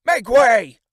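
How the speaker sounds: high, loud shout; crying out. A narrator's reading. Men around the fallen man cry out, loud shout. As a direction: clear the road by yelling. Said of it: "cried the men all about him".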